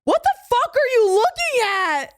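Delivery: high-pitched confrontational challenge; retold angry outburst. Friend re-enacting confronting a laughing stranger, angry. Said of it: "used to me being loud".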